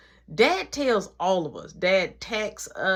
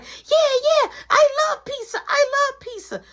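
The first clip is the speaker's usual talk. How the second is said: put-on high, loud excited child; excited kid; 6 dB louder than usual. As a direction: squeal it with joy. Little brother excited about pizza.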